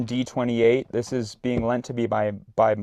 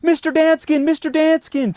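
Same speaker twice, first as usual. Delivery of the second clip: high, loud frantic call; frantic yell; 10 dB louder than usual. Retold or imitated. Terrified campers calling the counselor, high frantic yell. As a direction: shout for help in terror.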